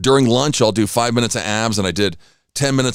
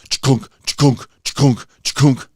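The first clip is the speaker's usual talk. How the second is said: clunky paw-step sounds, imitated; dog footsteps on the floor; 3 semitones higher than usual. Mimicking the dog walking through the house, clunky footstep noises. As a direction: mimic heavy paws on wood floors. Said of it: "it sounds like a human on four paws".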